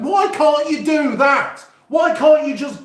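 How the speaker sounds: incredulous at a shop's silly rule; exasperated complaint. Retold or imitated. Complaining Domino's won't let him collect.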